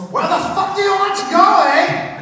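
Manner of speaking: high, loud mock Scottish bark; gruff accented GPS. Scottish GPS voice demanding a destination. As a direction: shout it like a rough Scot. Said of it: "it goes".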